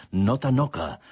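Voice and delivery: ordinary talk, nothing marked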